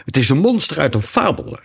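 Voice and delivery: high exclamation; excited unicorn's cry. A narrator's reading. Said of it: "riep de eenhoorn uit".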